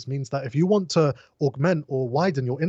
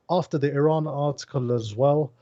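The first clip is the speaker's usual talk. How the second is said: usual speaking voice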